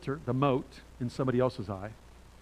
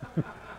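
breathy quiet chuckle; 8 dB quieter than usual. Real, not acted. Laughing at wife's joke.

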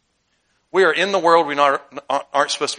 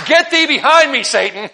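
higher, louder mock rebuke ending in a laugh; stern rebuke; 10 semitones higher, 8 dB louder than usual. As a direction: hurl the rebuke at Satan, then crack up. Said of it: "he said".

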